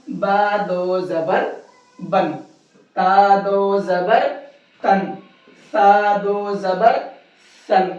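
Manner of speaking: slow, syllable by syllable: بن، تن، سن with a clear nasal ن at the end; slow drill for students; slow tanween drill. Shown as a demonstration. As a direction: spell it out slowly like a Quran teacher, ringing the ن in بن تن سن. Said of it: "کیسے پڑھ رہا ہوں میں اس کو؟".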